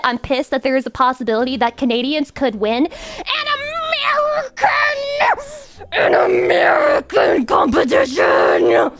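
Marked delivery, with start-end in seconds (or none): 2.9-8.9 high, slow wailing cry of American; hammed-up patriotic anguish; mock outraged cry. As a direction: wail it like a wounded patriot. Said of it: "I'm actually pissed about it".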